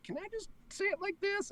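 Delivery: tentative request. Retold or imitated. Retold request in script session.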